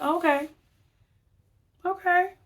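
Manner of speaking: a high, pointed okay that means I see you now; the relationship okay. Retold or imitated. To someone who just insulted her, high pointed okay. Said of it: "I'm like".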